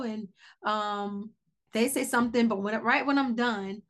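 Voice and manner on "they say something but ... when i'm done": normal talking voice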